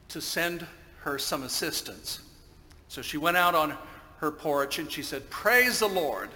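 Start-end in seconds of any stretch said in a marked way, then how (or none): 5.3-6.3 high, loud, slow exclamation of praise; shouted praise. Acted. Lady on her porch praying to God, shouted praise. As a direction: call it out to heaven, drawn out. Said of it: "and shout".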